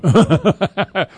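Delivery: a loud, real laugh that breaks out; burst of laughter. Real, not acted. Host bursts out laughing when co-host won't pick.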